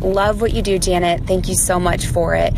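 usual speaking voice

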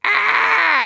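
sudden high exasperated yell; frustrated yell. Retold or imitated. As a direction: let out a short frustrated shout. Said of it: "and I'm just like".

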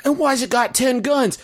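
high squeaky mock panic; mock alarm. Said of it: "silly duck bit".

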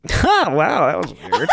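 high, airy "huh, wow," then dropping; acted-out casual bemusement, laughing; imitating girlfriend. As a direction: play innocent after a blow-up. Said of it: "my girlfriend was like".

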